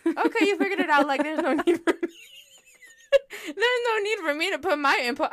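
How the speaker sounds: high, loud mock exasperation; exaggerated high reply. Retelling own reply to friend.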